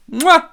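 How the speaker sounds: loud, high smacking kiss; a big theatrical mwah; chef's kiss. Real, not acted. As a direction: kiss your fingers loudly.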